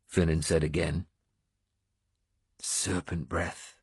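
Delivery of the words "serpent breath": slow, much softer, almost to himself; quietly. A narrator's reading. Wounded lord naming his lost sword, quietly. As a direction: murmur the sword's name, lost in thought. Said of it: "I said quietly".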